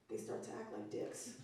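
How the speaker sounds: hushed slow punchline; whispered. Acted. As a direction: breathe the punchline. Unvoiced punchline.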